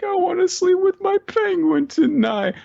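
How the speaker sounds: put-on very high childish whine; pleading little girl. Child asking dad at bedtime, whiny plea.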